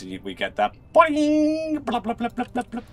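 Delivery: high, stretched boing then quick blahs; high boing blah sound. Retold or imitated. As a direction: imitate a cartoon boing sound effect. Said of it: "you know the sound effects I mean".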